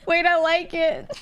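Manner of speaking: laughing. Real, not acted. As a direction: say it through giggles. Cracking up at joke name.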